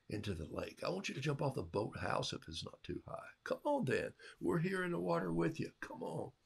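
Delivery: soft, encouraging coaxing; encouraging parent. Retold or imitated. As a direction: invite them gently to try. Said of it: "When the parent says".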